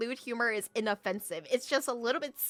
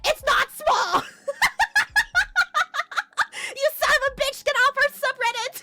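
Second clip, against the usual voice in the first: mock commenters yelling; 10 dB louder than usual. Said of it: "everyone in the comments are like".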